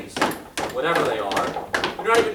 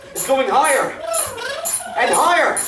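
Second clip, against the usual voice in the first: rising alarm; 7 semitones higher than usual. Acted. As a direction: voice climbing from report into panic. Officer sees the machine rising.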